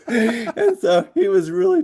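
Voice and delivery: high, laughing through the words; can barely hold back laughter; giggling while telling it. Real, not acted.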